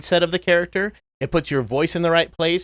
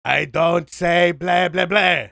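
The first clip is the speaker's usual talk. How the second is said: comic vampire voice, pitched up; Dracula; 4 semitones higher than usual. Shown as a demonstration. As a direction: stretch out each "blah" as an exasperated cartoon vampire. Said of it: "sometimes with Dracula".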